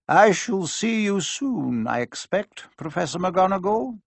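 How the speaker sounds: lightly voiced gentle headmaster; Dumbledore voice. A narrator's reading.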